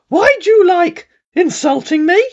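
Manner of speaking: high, loud plaintive whine; scammer voice. A narrator's reading. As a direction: complain like a wounded scammer. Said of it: "he said".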